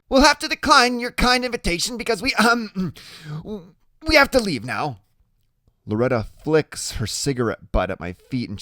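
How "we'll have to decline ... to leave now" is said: put-on nervous kid, high and loud; high-pitched chatter that stumbles into hesitation; chattery high. A narrator's reading. Freak declining Iggy's kind invitation, chattery high. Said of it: "his voice is chattery high".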